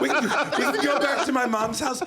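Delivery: high, hammed-up British accent; high mocking echo of a British suitor. Mocks the British suitor's invitation.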